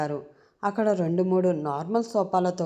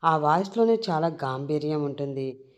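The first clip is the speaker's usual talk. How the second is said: usual speaking voice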